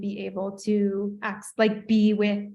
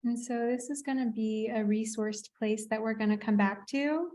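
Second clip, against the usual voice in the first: guided meditation. Acted. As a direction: ease them into calm, unhurried. Therapist guides visualization.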